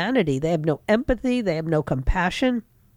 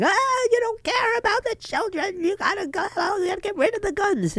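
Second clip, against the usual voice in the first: much higher, shrill-edged mock hysteria, faster at the end; mimicking an opponent's screaming tantrum; mocking screaming congressman; 11 semitones higher than usual. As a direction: parody a hysterical gun-control rant. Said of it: "he's screaming".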